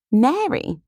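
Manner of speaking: high, loud, startled question; astonished high-pitched exclamation; incredulous. Acted. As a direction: blurt the name in disbelief. Kitty incredulous at mother's choice.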